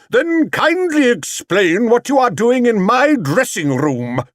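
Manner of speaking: very high, loud theatrical demand; booming. Acted. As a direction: boom out the question. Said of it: "boomed the great actor".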